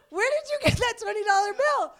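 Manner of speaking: put-on high, loud and startled at the child; a retold gasp of disbelief; startled question. Mother startled by her toddler's cash, high and loud. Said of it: "And I'm like".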